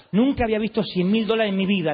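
ordinary talk, nothing marked

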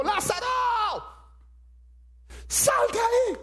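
high shout calling Lazarus out of the tomb; loud command. Retold or imitated. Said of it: "habla con voz alta". (Cristo llamando a Lázaro, grito alto y fuerte)

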